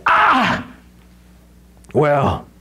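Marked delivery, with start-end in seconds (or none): 0.1-0.7 high "Ah" in a put-on demon voice; startled demon cry. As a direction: yelp like a caught demon. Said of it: "Literally, it's".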